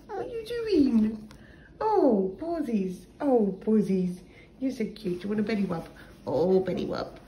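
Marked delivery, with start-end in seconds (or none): none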